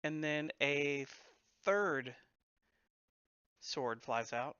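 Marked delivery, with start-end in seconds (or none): none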